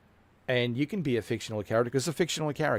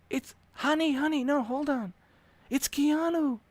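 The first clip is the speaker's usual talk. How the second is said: put-on much higher, breathless girlfriend hushing her man; excited girlfriend falsetto. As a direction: squeak it out like a giddy girlfriend.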